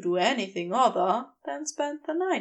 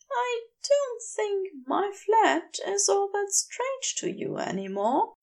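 lightly higher, gentle angel voice; Aziraphale voice; 9 semitones higher than usual. A narrator's reading. Aziraphale's voice in narration.

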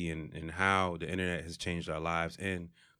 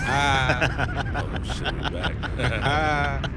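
stretched-out loud hype opener; hype intro shout; 6 semitones higher, 7 dB louder, much slower than usual. Acted. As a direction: draw it out like a show open. Hype show opening after intro.

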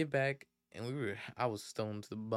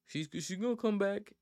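higher-pitched, lightly dopey imitation; mock dumb kid; 8 semitones higher than usual. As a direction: stumble into it like a clueless kid. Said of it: "comes back looking hella dumb".

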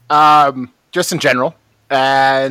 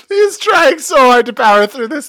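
laughing; 7 semitones higher, 4 dB louder than usual. Real, not acted. Laughing fit during podcast.

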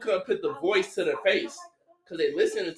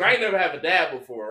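normal talking voice

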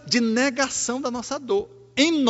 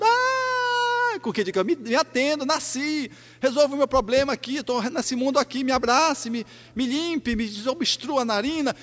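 high crying newborn voice; mock newborn wail; 6 semitones higher than usual. Preacher voicing a newborn demanding care, high mock cry. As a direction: wail like a baby just born.

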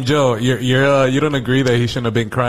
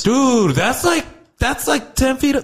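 stunned disbelief; 12 semitones higher, much slower than usual. Real, not acted. Friend reacting to the fall height, stunned and high-pitched. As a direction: pitch jumps way up, slow and amazed.

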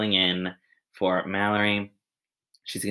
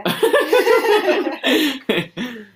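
loud high laugh; 10 dB louder than usual. Real, not acted. Host laughs loudly.

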